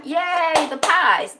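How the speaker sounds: high, loud mock cheer; hammed-up happy "yay"; oblivious cheering. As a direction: cheer like a family that doesn't understand. Family cheering, not understanding.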